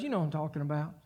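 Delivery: normal talking voice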